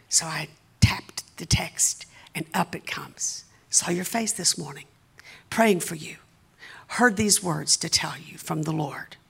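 low, soft, slow suspense; hushed reading. Retold or imitated. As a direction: read the message out in a near-whisper. Reading out a received text message.

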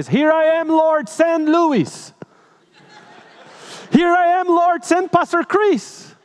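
high and slow, a sing-song dodge that names Louis, then Pastor Chris; mocking reluctant Christians. Preacher mocks Christians who send others instead of going. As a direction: drawl it out high, passing the job to someone else. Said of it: "many Christians say".

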